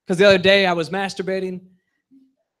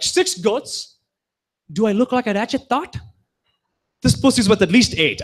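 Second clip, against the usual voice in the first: put-on higher sassy girl voice; sassy offended girl; 5 semitones higher than usual. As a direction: play her indignant and sassy.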